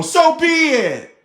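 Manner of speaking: so be it, slow and loud in defiance; defiant. Real, not acted. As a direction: fling it back defiantly. Defiant retort.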